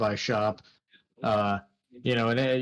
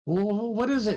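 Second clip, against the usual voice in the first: high, anxious call to the tower; Jack Tover calling up; 10 semitones higher than usual. Acted. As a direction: shout up asking what it is. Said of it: "you hear Jack Tover say".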